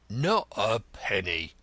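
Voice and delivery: blunt, growly refusal and reproach; gruff Grodman, refusing. Acted. Grodman refusing Denzil a loan, gruff.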